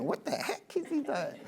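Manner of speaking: high, soft puzzled audience voice; mock audience. The coach voices his confused audience, high and soft. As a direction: play a baffled onlooker, lightly. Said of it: "you guys are probably saying".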